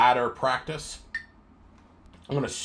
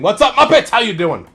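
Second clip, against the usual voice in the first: much higher, louder cheerful hello; excited greeting; 6 semitones higher, 7 dB louder than usual. Real, not acted. Streamer greeting his viewers, a sudden high, loud hello. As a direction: burst out with a big hello to the viewers.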